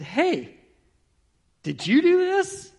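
high, loud surprised question; a boss amazed, calling out; surprised senator. Retold or imitated. Senator surprised at tidy mailroom.

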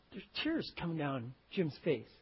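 ordinary talk, nothing marked

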